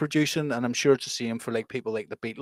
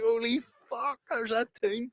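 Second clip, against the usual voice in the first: pitched way up with amazed delight; high excited disbelief; 9 semitones higher, 6 dB quieter, slower than usual. Retold or imitated. Friend recalls finding the tune on YouTube, high amazed squeal. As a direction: squeak it out like you finally found the lost track. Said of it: "I was like".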